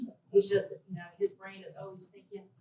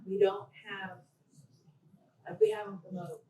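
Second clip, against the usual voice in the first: ordinary talk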